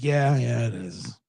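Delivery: low, deflated imitation of a diner owner; mock dejected, fading away; bummed out. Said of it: "he was really bummed out".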